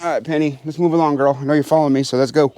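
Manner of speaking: ordinary talk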